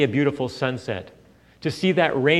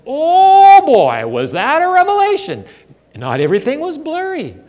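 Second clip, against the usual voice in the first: put-on amazed kid; 13 semitones higher, 9 dB louder than usual. Boy with new glasses marvelling that nothing is blurry, excited. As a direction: relive the thrill of seeing clearly. Recalls first wearing glasses.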